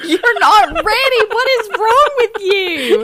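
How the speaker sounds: high, loud, laughing teasing; loud playful scolding. Real, not acted. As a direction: shout it half laughing. Teasing shout through laughter.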